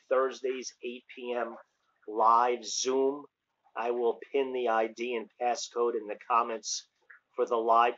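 usual speaking voice

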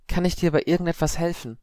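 high-pitched, careful question; gentle offer. A narrator's reading. Harry answering screaming Padma, gently offering help. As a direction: offer help without rising to the attack.

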